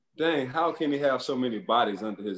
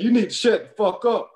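higher and louder retold shout; retold yell; 8 semitones higher, 6 dB louder than usual. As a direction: bark it back at him. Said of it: "He said".

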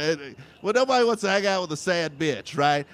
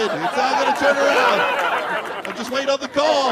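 forced optimism; 6 semitones higher than usual. Retold or imitated. Mock LA optimism.

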